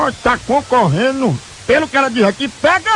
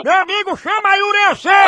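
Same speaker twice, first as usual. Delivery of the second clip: higher shouted demand; shouting; 8 semitones higher than usual. Acted. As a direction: yell at him to fetch her. Prank caller shouting on phone.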